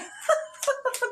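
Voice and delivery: high, breathless giggle; laugh. Real, not acted. Reader amused after singing to listeners, high giggle.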